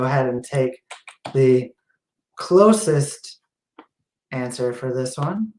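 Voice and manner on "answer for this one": ordinary talk, nothing marked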